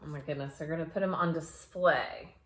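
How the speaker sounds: ordinary delivery, nothing marked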